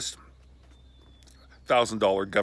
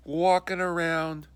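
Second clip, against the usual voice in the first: ordinary delivery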